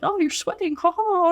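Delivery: high-pitched mock teasing of a coworker; playful teasing. Sous chef ribbing cook at the grill, playful teasing.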